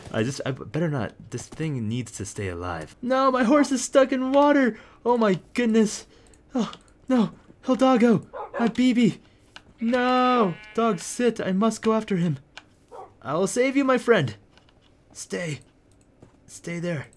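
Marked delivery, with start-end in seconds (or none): 3.0-10.5 high, loud frantic cries; panicked for the horse in the water; hammed-up horror, much higher. Real, not acted. 10.7-17.1 high-pitched, noble rescuer; playful knight-style vow, high; mock heroic.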